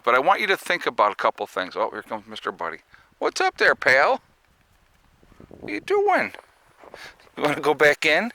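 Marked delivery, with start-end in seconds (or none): 3.2-8.3 much higher, sweet voice for the dog; pet talk. Real, not acted. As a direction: coo at the dog. Talking to his dog.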